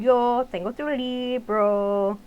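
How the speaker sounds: slow, drawn-out Spanish teacher voice; imitating tall teacher. Teacher holding the textbook, slow Spanish. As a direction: stretch out Yo tengo tu libro like a big cowboy teacher.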